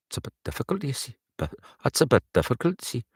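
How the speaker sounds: rehearsing a Newcastle woman's accent. Shown as a demonstration. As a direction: practise a lilting Geordie line. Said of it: "Newcastle's an awfully difficult accent".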